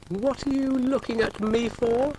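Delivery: high, loud indignant protest; loud high indignant yelp. Acted. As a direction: protest at being eyed for thread. Dougal indignant at being eyed.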